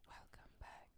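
breathy whispered welcome; barely audible whisper. Acted. Joins the whispered intro.

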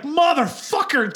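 high, slow drawn-out curse; pained curse. Retold or imitated. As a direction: drag the curse out through the pain. Finger bitten.

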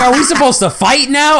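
high, puzzled rising question; confused kid voice. Retold or imitated. Retelling sibling's confused question.

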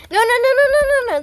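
high, loud burst of objection; urgent protest. Real, not acted. Host stopping the cohost from ending the episode, urgent protest.

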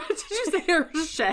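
delighted teasing through laughter; laughing. Real, not acted. Cohost catching the accented Hershey, laughing. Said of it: "made an accent".

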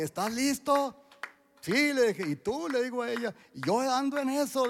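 high-pitched acted back-and-forth; imitating eager question and answer; retold eager exchange. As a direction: pitch it well up, replaying a lively exchange. Eager father-daughter exchange acted out.

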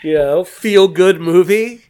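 laughing, mock-cheerful praise; high and loud, dripping with irony; sarcastic. Real, not acted. Ironic praise of a grim film.

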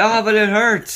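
high whiny complaint; mock whine. Co-host plays injured Martin whining at his son, high and whiny. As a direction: whimper it like a big baby.

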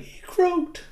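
squeaky high cookie voice; high little cookie squeak; mock gingerbread man; Gingy. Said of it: "Gingy's like".

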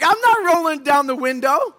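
high, loud imitated refusal; mock defiant refusal. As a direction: refuse him loudly. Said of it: "I'm like".

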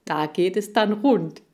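measured, emphatic advertising voice building to a cue; TV contest announcer. Acted. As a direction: announce the contest with salesman's gusto.

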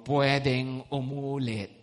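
"Pwedeng umulit" said slow and low to oneself; slow self-talk. Retold or imitated. Preacher voicing what listeners tell themselves, slow and low. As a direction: say it slowly like a reminder to yourself. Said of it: "Sabihan mo sarili mo".

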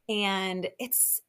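ordinary talk

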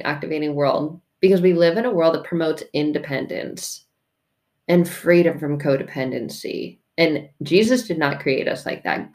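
ordinary delivery

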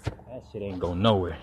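ordinary delivery

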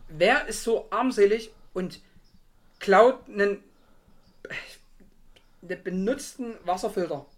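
loud, slow disbelieving outrage; indignant. Real, not acted. Rant about stolen water filter.

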